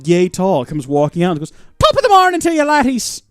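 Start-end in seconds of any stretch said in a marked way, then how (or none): 1.8-3.2 high, loud hammed-up Irish accent; Irish accent impression. Streamer voicing a tiny Irish man, hammed-up Irish accent. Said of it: "why he's Jacksepticeye".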